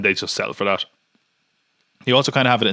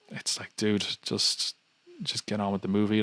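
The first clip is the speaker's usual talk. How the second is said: much softer, fed-up muttered plea; exasperated aside; 8 dB quieter than usual. Real, not acted. Viewer tired of the ferry scene, softly and fed up. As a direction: sigh it out, worn down by the scene.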